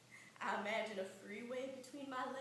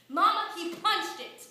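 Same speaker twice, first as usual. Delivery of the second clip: higher, loud plea to mama; loud child's protest; 6 semitones higher, 12 dB louder than usual. Acted. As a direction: protest loudly to your mother.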